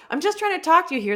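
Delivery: high, fast put-upon whine; hammed-up impatient complaint; mock exasperation. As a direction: rattle off the plea, fed up. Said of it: "they're being like".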